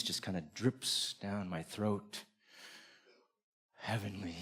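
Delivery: low, very soft, savoring murmur; hushed bliss. Acted. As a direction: let the word sink out softly, as if savoring a bite. Savouring first bite of forbidden steak.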